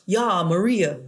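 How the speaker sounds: a hint of a tough Schwarzenegger thug; lightly voiced thug calling his wife; super thug Schwarzenegger. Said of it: "super thug".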